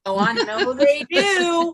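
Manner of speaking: high, slow, laughing agreement. Real, not acted. [name] laughing back at Susan's Amazon joke, high and slow. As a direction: laugh through it, drawn out. Joking about Amazon loving them.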